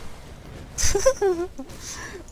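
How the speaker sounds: high giggle at own joke; laughing. Real, not acted. Gamer laughing at his channel name pun, high giggle. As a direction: crack up at your own pun. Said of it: "I laugh every time I say that".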